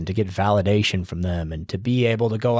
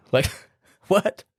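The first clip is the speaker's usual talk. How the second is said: laughing disbelief; 9 semitones higher than usual. Retold or imitated. Mocking awkward host.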